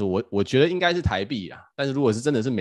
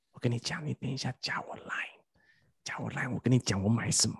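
retold secretive whisper; 8 dB quieter than usual. Secret tip inside the shop.

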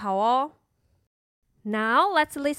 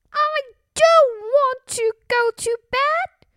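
put-on very high, loud, slow child protest; defiant little princess; 12 semitones higher, 6 dB louder, slower than usual. Princess back downstairs refusing bed, loudly defiant. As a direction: dig in as a stubborn little girl.

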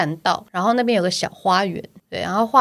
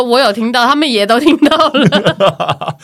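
sister laughing, embarrassed; 3 semitones higher, 7 dB louder, slower than usual. Retold or imitated. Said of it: "然后我妹就说".

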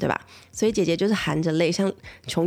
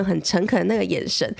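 ordinary talk

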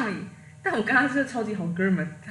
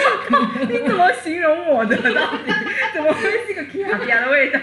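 indignant laughing exclaim; 9 semitones higher, 7 dB louder than usual. Retold or imitated. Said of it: "我说：".

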